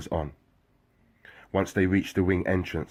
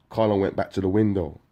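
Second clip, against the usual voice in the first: ordinary talk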